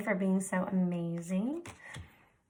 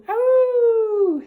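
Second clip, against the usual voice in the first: high loud wolf howl on Oh; 13 semitones higher, 9 dB louder than usual. Shown as a demonstration. Teacher to students: a loud spooky howl. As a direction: howl like a wolf to scare them. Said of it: "give me a howl".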